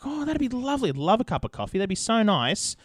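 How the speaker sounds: high, gushing mock delight. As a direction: gush over the offer like a polite guest. Mock eager reply to tea offer.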